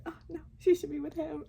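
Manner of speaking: high, quick, hammed-up change of heart; retold torn reaction. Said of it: "And then the next guy".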